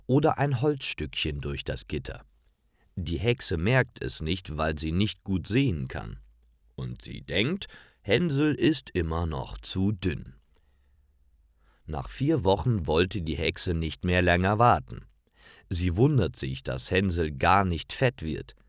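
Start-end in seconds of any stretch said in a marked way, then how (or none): none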